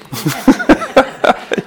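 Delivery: high, loud burst of laughter; real laugh. Real, not acted. Teacher amused at how meditators coped so far, bursting into laughter. Laughs at his own remark.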